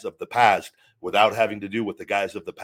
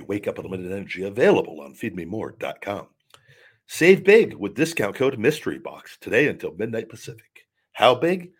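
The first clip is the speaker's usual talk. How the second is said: upbeat pitchman voice for a commercial; ad read; 5 dB louder than usual. Pretend. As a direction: sell it like a radio ad. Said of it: "that's my next commercial".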